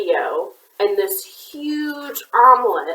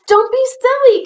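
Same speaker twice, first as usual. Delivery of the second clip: high cackling hen; a hen cackling high and loud; cackled; 5 dB louder than usual. A narrator's reading. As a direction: cackle it out much higher. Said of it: "cackled the chicken".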